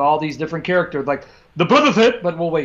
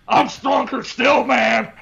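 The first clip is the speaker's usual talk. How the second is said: slow, higher shouted wrestler boast; hammed-up wrestler voice; 6 semitones higher, much slower than usual. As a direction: bellow it like a pro wrestler hyping a match. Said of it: "Halal Hogan versus Me Strong".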